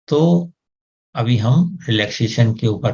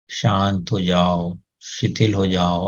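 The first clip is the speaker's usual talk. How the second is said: slow, softer soothing words; slow soft calming command; 3 semitones lower than usual. Shown as a demonstration. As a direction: quietly settle the nose. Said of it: "नाक को बोलें". (धीमा नरम शांत आदेश)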